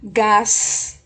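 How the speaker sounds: GÁS stretched with a long hissed S; teacher sounding out GÁS on its own; emphatic syllable demo. Shown as a demonstration. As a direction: hold the final S of GÁS. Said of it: "ela vai ser".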